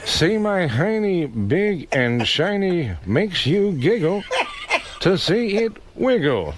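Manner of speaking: slow sing-song high voice with laughter; Ted Koppel impression. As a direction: stretch it out high and silly. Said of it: "let's hear Ted Koppel".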